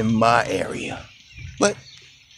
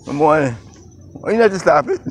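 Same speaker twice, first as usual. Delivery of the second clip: loud, high greeting to a stranger; calling out hello; 7 semitones higher, 7 dB louder, faster than usual. Real, not acted. Vlogger hails another walker on the sidewalk, loud and high. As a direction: call out friendly across the way.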